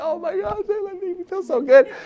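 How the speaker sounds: high, gushing gratitude; mock delighted response. Person just complimented by an encourager, gushing back. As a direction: melt at the compliment, high-pitched. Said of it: "You're like".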